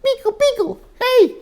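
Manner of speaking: very high squeaky call; Beagle calling. Acted. Beagle calling from the picture, high and squeaky. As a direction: call out his name in a tiny high voice. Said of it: "Beagle's over there saying".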